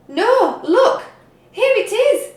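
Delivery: high, loud excited discovery; excited cry. A narrator's reading. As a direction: call out thrilled at finding it. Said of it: "cried Peg Polka Dot".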